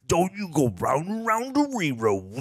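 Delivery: put-on parodying a crime film trailer, mumbled tough-guy London accent; mock Guy Ritchie gangster. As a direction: ham up a nonsense British thug line from a movie trailer. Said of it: "a trailer for a Guy Ritchie movie".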